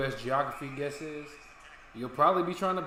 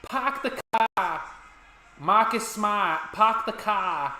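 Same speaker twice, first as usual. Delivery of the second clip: "park the car" as "pahk the cah", slow, higher and louder; hammed-up Boston accent; Massachusetts accent; 6 semitones higher, 7 dB louder, slower than usual. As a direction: drop the r's like a Boston local. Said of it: "Massachusetts accents be like".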